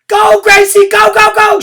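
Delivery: very high, loud cheer; cheering shout. Acted. Room nine cheering Gracie on, very loud. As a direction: cheer her on at full voice. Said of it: "shouted room nine".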